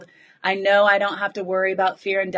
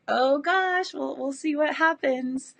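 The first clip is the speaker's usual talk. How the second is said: higher, wary little mock gulp; mock nervous; 6 semitones higher than usual. Retelling her nerves before the switch, mock nervous and higher. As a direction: lightly play her own nervous self.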